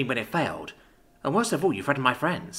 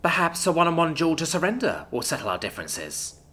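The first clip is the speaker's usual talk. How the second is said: higher, controlled, icy proposal; cold formality; 6 semitones higher than usual. A narrator's reading. Alora proposes a duel to Isadora, coldly. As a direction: offer a duel with cool politeness. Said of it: "Alora coldly addressed Isadora".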